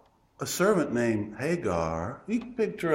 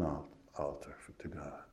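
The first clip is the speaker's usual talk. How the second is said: hushed whisper; 9 semitones lower, 18 dB quieter than usual. Acted. Preacher describing the altar to God, whispered reverently.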